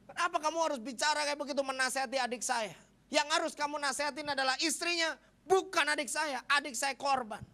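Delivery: high, fierce imitation of a scolding brother; retold angry rant. Brother defends his sibling to pastor, acted angry rant. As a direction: scold at full pitch, acting out his outrage. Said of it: "Kenapa kamu harus marah-marah".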